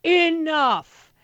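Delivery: loud, high, ringing "Enough"; forceful declaration. Shown as a demonstration. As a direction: declare "Enough" like a rally cry. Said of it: "I want you to talk like this".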